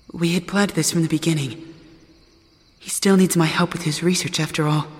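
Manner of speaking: ordinary talk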